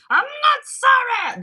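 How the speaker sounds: high, loud, slow I'm not sorry; gleeful not sorry. Retold or imitated. Imitating Pee Wee Herman taunting after the nurse leaves, high and loud. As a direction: flip the apology into a triumphant squeal. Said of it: "he goes".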